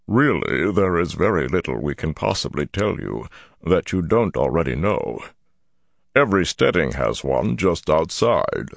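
gravelly, deliberate elder voice, uneasy yet dignified; ponderous Ogier elder. A narrator's reading. Ogier elder telling Rand about waygates, ponderous gravelly voice. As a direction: explain it with weighty reluctance.

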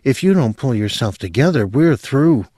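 controlled ultimatum, held in check; level, controlled. A narrator's reading. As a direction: keep it even while giving a warning. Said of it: "in a level, controlled voice".

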